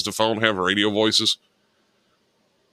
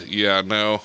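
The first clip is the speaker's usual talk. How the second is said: usual speaking voice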